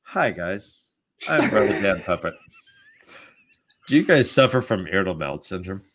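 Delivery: mock ad host. Fake ad host voice.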